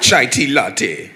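loud, slow, hammed-up Australian accent; drawn-out comic Australian imitation. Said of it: "that's my Australian accent".

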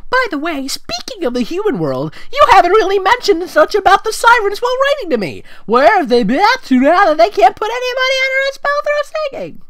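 high, loud cartoon princess, eager to help; squeaky Twilight voice. Reader voicing Twilight suggesting a name, high and squeaky. Twilight asks about sirens.

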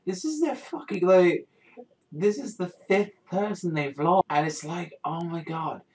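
high-pitched, overwrought disbelief; distraught fan rant. Real, not acted. Fan ranting about repeated character deaths.

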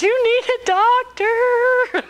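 high, very drawn-out retort; mock exasperated husband. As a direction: drag out each word at a hysterical spouse. Husband's groggy retort, acted.

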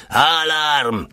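high shouted call into the night. Acted. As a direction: call it out sharply, pitched high. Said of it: "krzyknął". (generał każe majorowi krzyczeć, głośny okrzyk „Alarm!”)

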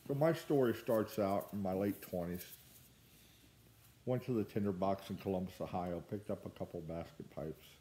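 talking around pipe stem; speaking through clenched teeth; pipe in mouth. Real, not acted. Seen 0: Pipe clenched in teeth throughout; smoke exhaled while talking.

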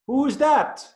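put-on high, loud boy's call of who's there; a boy shouting at an unseen kid; frustrated little boy. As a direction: call out crossly into the valley.

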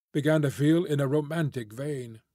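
plain reading voice

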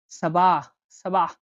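সাবাহ with a deep, heavy emphatic s from the throat; deep emphatic Arabic sad. Shown as a demonstration. Teacher models সাবাহ for learners, deep emphatic sound. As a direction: say সাবাহ with the tongue pulled back, sound weighty. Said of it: "গভীরভাবে বলতে হয়".

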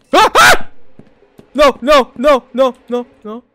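loud high cry of no no no; panicked scream. Real, not acted. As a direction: beg it off in panic. Monster attack, shouting no.

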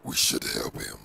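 normal talking voice

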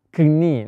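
"knie" with the k sounded before the n; "knie" as k-nee, a mistake to avoid; wrong pronunciation. Shown as a demonstration. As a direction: say "knie" wrongly, voicing the silent k. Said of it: "No vayamos a pronunciar como".

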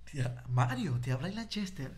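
deep-voiced caller introducing himself. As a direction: lightly imitate a big low phone voice. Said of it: "[name] tiene un vocerrón".

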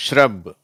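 ஷ்ரப் with sh and r merged into one onset; teacher modelling ஷ்ரப் for learners; model word shrub. Shown as a demonstration. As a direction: say ஷ்ரப் slowly and clearly as a model. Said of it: "இதை நான் வாசிக்கிறேன்".

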